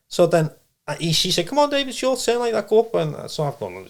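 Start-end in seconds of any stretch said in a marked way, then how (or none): 1.5-2.9 lifted much higher, calling him up; perky high call up the stairs; high bright beckon; mock high medium voice.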